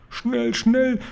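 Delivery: very high, pleading moan; acted high-pitched begging; groaning urgency. A narrator's reading. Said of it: "stöhnte der Fleischfetzenfresser".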